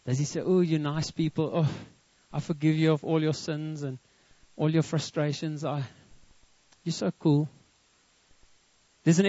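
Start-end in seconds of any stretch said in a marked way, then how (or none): 0.4-7.5 soft, low, mock-forgiving; mock sweetness. Preacher imagining a forgiving Moses, in mock sweetness. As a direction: understate it in a gentle, ironic murmur.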